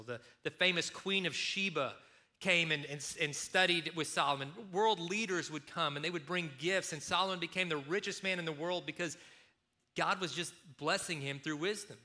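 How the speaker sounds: ordinary delivery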